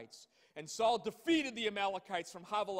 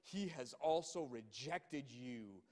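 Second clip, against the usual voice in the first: much lower and softer, grave; solemn rebuke; 8 semitones lower, 6 dB quieter than usual. Acted. Samuel pronouncing judgment on Saul, low and grave. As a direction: deliver the judgment quietly.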